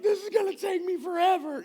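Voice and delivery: put-on high, loud wailing child; shouted, very high child's despairing whine; whiny kid wail. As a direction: cry it out like an overwhelmed little boy. Son wailing at messy room.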